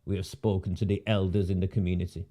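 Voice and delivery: low, soft mocking repeat; dismissive mimicry. After calling the official a numpty, low dismissive mimicry. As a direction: parrot it under your voice, low and dismissive.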